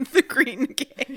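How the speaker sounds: laughing through the words. Real, not acted. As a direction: giggle it out. Repeating joke while laughing.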